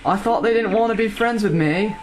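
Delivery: high British voice; British accent. Pretend. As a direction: sound like a British boy. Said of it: "you make a good British accent".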